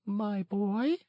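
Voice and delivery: soothing and unhurried; calm, peaceful. A narrator's reading. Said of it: "in a calm, peaceful voice".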